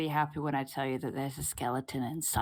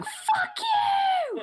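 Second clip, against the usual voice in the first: far-off furious scream, very high; distant scream; 5 dB louder than usual. Acted. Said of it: "a really muffled kind of scream".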